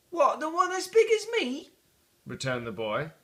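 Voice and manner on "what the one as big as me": put-on much higher, amazed child; a boy's voice. The boy in the street asking about the turkey, amazed. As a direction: squeak it out like an astonished small boy.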